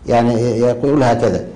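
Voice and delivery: ordinary talk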